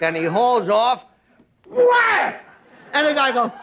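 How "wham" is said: loud high wham like a kick landing; kick sound wham. Acted. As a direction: punch out the impact noise. Farmer kicks guy in groin.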